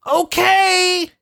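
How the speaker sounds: high excited shout; yelled. Retold or imitated. Spike yells in retold scene.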